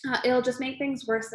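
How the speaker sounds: ordinary delivery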